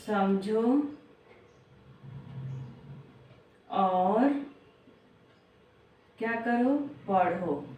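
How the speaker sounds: each word drawn out with long pauses; slow, word by word. Shown as a demonstration. Teacher to young pupils, slow deliberate phrasing. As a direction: spell out the steps slowly for the children. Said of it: "देखो, समझो और पढ़ो".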